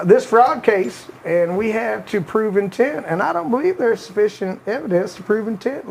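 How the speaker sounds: put-on "This fraud case" drawn out very slowly, Southern Clinton accent kept; slow Clinton quote again. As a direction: linger on "fraud" as Clinton reading the judge. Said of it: "not even gonna try it".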